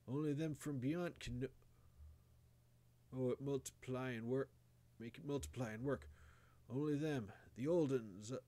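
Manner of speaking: halting, urgent deathbed warning in country dialect; dying old grandfather. A narrator's reading. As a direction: stumble through last orders to a grandson. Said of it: "he had another sentence or two".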